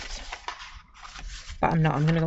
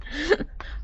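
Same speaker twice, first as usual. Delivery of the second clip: short bright laugh; a quick amused laugh; 10 semitones higher than usual. Real, not acted. Laughs at viewer's comment.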